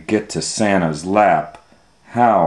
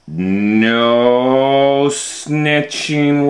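slow drawn-out no snitching; stretched warning to a child; stern scolding; 4 semitones higher, much slower than usual. Acted. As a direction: scold him slowly, drawing it out. Scolding David over cookies.

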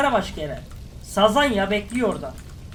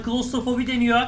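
normal talking voice

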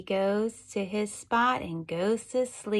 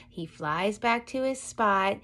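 ordinary talk, nothing marked

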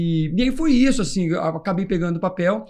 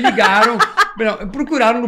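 laughing; 6 semitones higher, 6 dB louder than usual. Real, not acted. Laughing while telling story.

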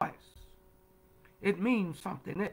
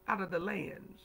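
softer, lower repeat of the phrase; hushed echo; 4 semitones lower, 9 dB quieter than usual. Real, not acted. Preacher echoes the verse to let it sink in, hushed. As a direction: let it settle quietly after the proclamation.

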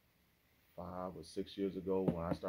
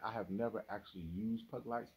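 ordinary talk, nothing marked

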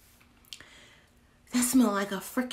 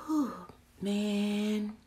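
ordinary talk, nothing marked

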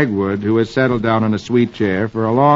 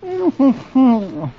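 drowsy laugh; 12 semitones higher than usual. Acted. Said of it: "But Dagwood just answers".

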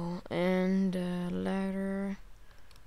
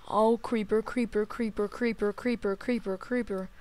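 panicked repeating; 3 semitones higher, 4 dB louder than usual. Real, not acted. Creeper approaching alarm.

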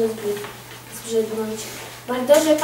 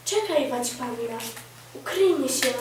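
usual speaking voice

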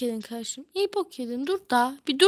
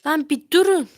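shout; 4 semitones higher, 11 dB louder than usual. Real, not acted. Friends grabbing the controls.

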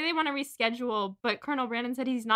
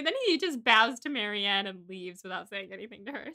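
normal talking voice

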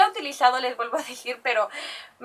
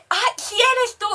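much higher, laughing, delighted; a fan recognising her in the street, excited; excited recognition; 10 semitones higher, slower than usual. Retold or imitated. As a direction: squeal it like you just spotted a friend. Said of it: "me dice".

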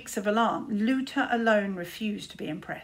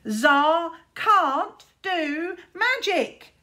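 slow, high, loud sing-song jeer; mocking singsong taunt; 8 semitones higher, 8 dB louder, much slower than usual. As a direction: chant it like a playground bully. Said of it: "taunted Luther".

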